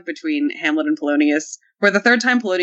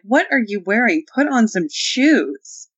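higher, drawn-out motherly scolding; exasperated mom; 4 semitones higher, slower than usual. Acted. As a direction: scold like his appalled mom. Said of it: "I felt like his mom".

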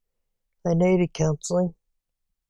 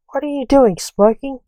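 polite; 6 semitones higher than usual. A narrator's reading. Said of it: "said Lance politely".

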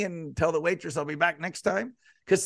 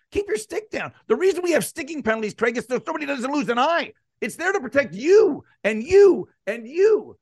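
higher, stern mock lecture to a player; mock referee lecture; 8 semitones higher, 3 dB louder than usual. Ref scolding a player about high sticks, stern. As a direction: wag a finger at him like a ref.

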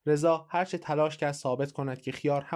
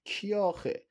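lightly voiced drowsy wife, pitched higher; a higher-pitched complaint from someone roused from a nap; dozing wife; 6 semitones higher than usual. A narrator's reading. Dozing wife asks who calls at night, lightly voiced.

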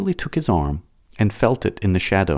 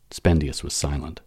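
plain reading voice

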